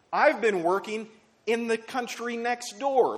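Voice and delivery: high-pitched, insistent pulpit delivery; emphatic preaching. Acted. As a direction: drive each point home as God's answer. Preaches as God answering Elijah.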